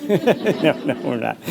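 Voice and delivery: laughing through a firm no; laughing refusal. Retold or imitated. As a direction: laugh while turning it down.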